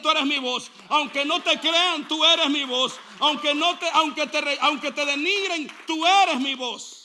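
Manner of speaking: from steady to loud and insistent; building emphatic voice of God. Acted. Dios habla a Juan, creciendo. (Dios hablando a Juan, cada vez más fuerte)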